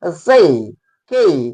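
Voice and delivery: जे and के each falling from e into a half i; half-i ending. Shown as a demonstration. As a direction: say जे, के as 'jey, key', cutting the final i short. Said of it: "निकालना पड़ता है ऐसे".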